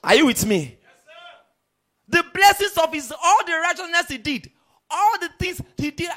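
loud, high, fervent preacher's cry; full-force preaching. Acted. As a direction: preach it out to the back row.

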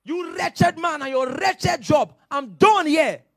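slow, high, loud angry shout at the boss; lady shouting at her boss, furious. Retold or imitated. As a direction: yell it as she quits. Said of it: "The lady was so angry with her boss".

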